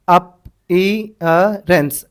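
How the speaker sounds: Appearance very slowly, piece by piece; sounded out. Shown as a demonstration. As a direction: break appearance into separate drawn-out sounds. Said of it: "appearance कैसा दिख रहा है".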